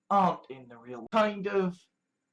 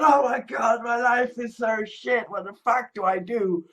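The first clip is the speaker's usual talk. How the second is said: mock despair; 4 semitones higher, 4 dB louder than usual. Mocking a depressed viewer.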